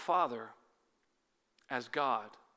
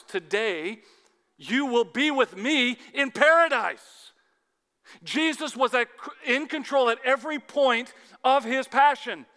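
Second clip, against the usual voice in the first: fervent preaching; 8 semitones higher, 8 dB louder than usual. Acted. Preacher proclaiming Jesus' promise, loud and fervent. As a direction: proclaim it with full force.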